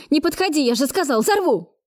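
high, agitated warning; panicked threat. A narrator's reading. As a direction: warn them off in a high, desperate voice. Cornered arsonist threatens to blow up. (отчаянная угроза высоким голосом)

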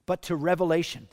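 ordinary delivery

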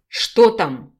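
loud, urgent question; exclamation. A narrator's reading. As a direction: call it out with alarm. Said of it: "воскликнул оперативник". (громкое восклицание)

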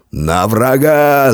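high, long drawn-out charge call; roared battle cry. A narrator's reading. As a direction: roar the men into attack. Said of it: "заревел он".

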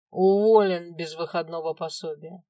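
loud cold verdict; stern loud order. A narrator's reading. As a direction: announce it harshly. Boss fires guard.

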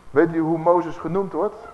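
ordinary talk

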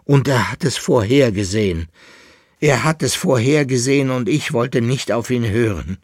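high, loud, mounting despair; despairing lament. A narrator's reading. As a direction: bewail it, rising to desperate cries. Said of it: "stöhnte der Leitnitzer". (verzweifelt klagend, immer lauter)

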